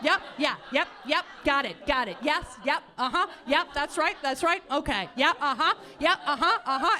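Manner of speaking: terse, rapid uh-huhs and yeps; mimicking dad ending the subject; dad's clipped phone replies. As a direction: fire off distracted phone affirmations. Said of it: "this is what he did".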